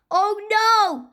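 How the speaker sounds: high, dismayed story-character shout; alarmed shout. A narrator's reading. Cheeseball to Fluffy while tumbling through the air, alarmed shout. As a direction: cry out in mild panic, pitch jumping up. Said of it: "shouted Cheeseball".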